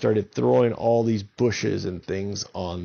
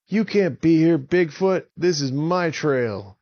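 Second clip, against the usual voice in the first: loud, higher yell at Bigfoot; angry shout; 7 semitones higher, 5 dB louder than usual. Acted. Avon yelling at Bigfoot to leave his trail, angry shout. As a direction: shove him off the trail with your voice.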